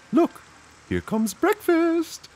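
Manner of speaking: very high, slow cartoon cow voice; a squeaky delighted cow. Hungry cow greeting breakfast, very high and slow.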